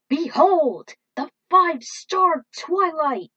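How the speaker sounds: loud, rising hero voice, building to a roar; heroic duelist. Acted. Yugi announcing his big summon to the enemy, triumphant.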